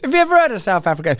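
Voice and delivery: normal talking voice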